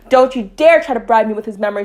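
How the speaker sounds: loud, rising warning; angry warning. A narrator's reading. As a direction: raise the voice in warning. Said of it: "she warned, her voice rousing the decibel".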